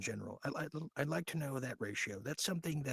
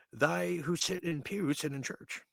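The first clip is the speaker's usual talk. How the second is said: mock preacher; 5 semitones higher, 5 dB louder than usual. Mock sermon quoting doublespeak.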